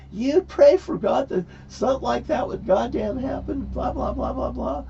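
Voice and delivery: high-pitched mock freak-out; imitating freaked-out woman. Marietta freaking out at him upstairs, high mocking rant. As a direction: scold in a high voice, tailing into blahs. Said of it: "come freaking out upstairs".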